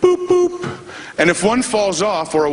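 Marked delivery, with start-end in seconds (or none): 0.0-0.8 high, even electronic boops; little high-pitched machine blips; monitor beep. Retold or imitated. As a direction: imitate a heart monitor beeping. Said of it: "it's just a little boop".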